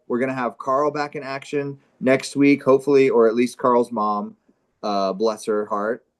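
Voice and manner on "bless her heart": ordinary talk, nothing marked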